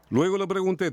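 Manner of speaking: usual speaking voice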